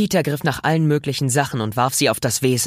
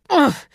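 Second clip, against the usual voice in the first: sharp high "oof" as if struck; knocked-breathless grunt; high winded grunt; 14 semitones higher, 5 dB louder than usual. Acted. Peter hit by Venom.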